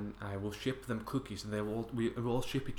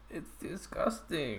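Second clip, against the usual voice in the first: disgusted; 5 semitones higher, slower than usual. Real, not acted. Reacting to milk and hot dogs.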